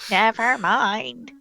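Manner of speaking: slow, exaggerated drawn-out words; mock dismissal. Real, not acted. Host withdrawing a muddled theory, drawn out in jest. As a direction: stretch it out jokily, rising at the end.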